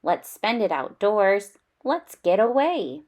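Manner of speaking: ordinary delivery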